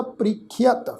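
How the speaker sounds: "ਪ੍ਰੀਖਿਅਤ" sounded pree-khi-at; half yayya in "ਪ੍ਰੀਖਿਅਤ" said as short i plus a; demonstrated pronunciation. Shown as a demonstration. Teacher answering how the word is recited, careful pronunciation. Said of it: "ਪਾਠ ਕੀ ਉਚਾਰਨ ਹੋਏਗਾ".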